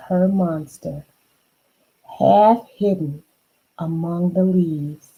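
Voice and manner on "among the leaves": usual speaking voice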